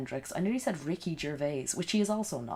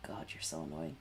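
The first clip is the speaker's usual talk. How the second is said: whisper; 9 dB quieter than usual. Real, not acted. Muttering to herself about streamer.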